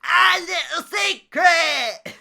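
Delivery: very high, loud, slow eerie cry; shouted secret. Pretend. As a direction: wail the secret from the distance. Player shouts the secret line.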